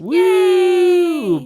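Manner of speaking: very high, loud woo; celebratory whoop. Retold or imitated. Host cheering Finn and Jake's success, loud whoop. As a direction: let out a long cheering whoop.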